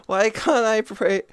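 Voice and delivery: ordinary talk, nothing marked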